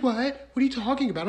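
high-pitched feigned bafflement; mock clueless wife. Wife answering cop about undercover officer, mock cluelessness. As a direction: play dumb in a high imitation voice.

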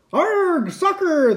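very high echoing pirate cry; crew yell. A narrator's reading. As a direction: echo the captain as a crew. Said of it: "the crew yelled".

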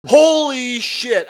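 slow, loud, high outburst; excited exclamation. Real, not acted. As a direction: drag it out in disbelief. Shocked outburst about commercial.

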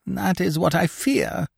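high, plaintive whimper. A narrator's reading. Injured Scaramouche fearing a dislocation, whimpering. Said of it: "whimpered Scaramouche".

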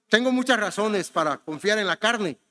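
ordinary talk, nothing marked

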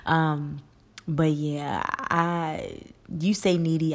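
ordinary talk, nothing marked

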